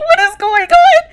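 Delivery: very high squeal of mock bewilderment; retold stunned disbelief. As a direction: squeak the question out, cracking up. Own stunned reaction, retold through laughter.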